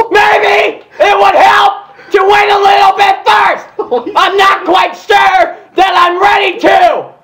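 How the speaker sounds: very high, slow, loud strained squeak; shy pony squeaking loudly; squeaky Fluttershy voice. Acted. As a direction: squeeze it out in a high throat-hurting squeal. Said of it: "This may hurt my throat".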